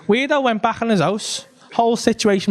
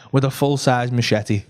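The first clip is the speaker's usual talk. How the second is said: ordinary delivery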